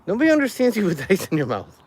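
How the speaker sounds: laughing, teasing. Real, not acted. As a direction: tease her through a laugh.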